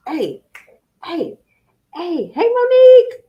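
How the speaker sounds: hey hey rising to a loud high Monique; calling out. Real, not acted. Host greeting viewer Monique, rising to a loud high call. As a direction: shout a happy greeting to her.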